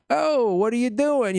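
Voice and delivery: lightly voiced bully picking a fight; schoolyard bully. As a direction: taunt like a playground bully. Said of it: "just like a schoolyard bully".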